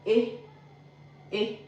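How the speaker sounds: "E" said as a short "ay"; letter-sound demo. Shown as a demonstration. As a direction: sound out "E" as a pure, short "eh" as in café. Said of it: "E is pronounced as".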